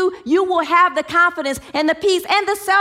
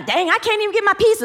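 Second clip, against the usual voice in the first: quick, higher, exasperated grumble; mock frustrated whine; 7 semitones higher, faster than usual. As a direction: play a fed-up customer, faster and higher. People freaking out in storm.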